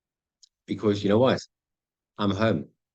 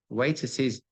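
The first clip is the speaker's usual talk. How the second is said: usual speaking voice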